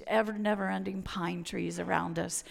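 usual speaking voice

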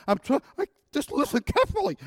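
high, loud, muffled struggle to be understood; swollen-lip speech. Retold or imitated. Patient begging the nurse to listen, swollen-lip speech. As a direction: strain to get words past the swollen lip. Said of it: "this is the way I sounded".

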